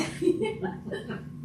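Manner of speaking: high, breathy burst of laughter. Real, not acted. Laugh after joke.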